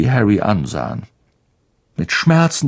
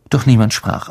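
ordinary delivery

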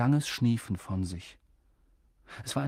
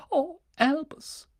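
mournful sigh of a name; slower than usual. A narrator's reading. McGonagall grieving.

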